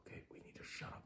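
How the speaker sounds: urgent whisper. Retold or imitated. Soldier whispering to his team with the enemy close. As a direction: breathe it so the enemy can't hear.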